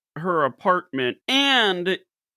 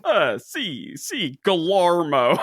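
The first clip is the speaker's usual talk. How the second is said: slow drawled Texan French; Texan-French accent; slower than usual. Retold or imitated. Imitating the Texan exchange student speaking French, in a Texan accent. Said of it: "French with a thick Texan accent".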